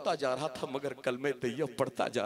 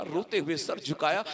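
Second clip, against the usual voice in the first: ordinary delivery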